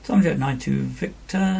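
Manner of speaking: ordinary talk, nothing marked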